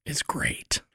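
rough, gravelly voice after covid; gravelly voice, barely there. Real, not acted. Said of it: "sounds like covid punched your vocal cords".

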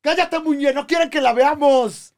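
high, hammed-up conspiracy yell; mock shout. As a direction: shout it like a paranoid conspiracy theorist.

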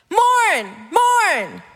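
hammed-up pious exhortation; mock religious command. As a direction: intone it like a stern liturgical demand.

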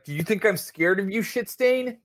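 high, taunting thug voice pushed up in pitch; menacing armed dealer. Acted. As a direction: threaten him with a sneering laugh in the voice. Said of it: "he's like".

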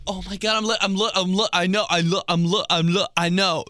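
high, loud stammering protest; flustered mock pleading. Imitating self as kid cornered by mom, stammered pleading. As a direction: stammer desperately, unable to finish.

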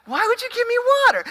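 high-pitched, rushed mock complaint; mock protest. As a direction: shoot way up high and fast, acting out indignation. Acted protest at offered water.

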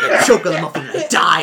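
loud, laughing through words; laughing announcement. Retold or imitated. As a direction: announce the death while cracking up. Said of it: "be like".

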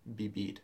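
vivir said as "bibir"; both V's in vivir turned to soft B's. Shown as a demonstration. Teacher repeating the Spanish pronunciation for Liz, V like B. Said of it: "pronounce it more like a B".

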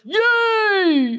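high happy shout; excited cheer. Acted. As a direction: cheer with glee. Puppet cheers.

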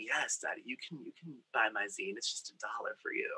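soft, breathy seductive whisper; flirty whisper. Retold or imitated. Flirting with a hot customer at the copier, whispered. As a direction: purr it like a come-on.

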